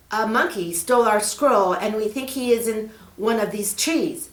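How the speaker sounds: put-on a worried kid asking for help; young boy Peter. Boy asks the snake about the scroll, eager.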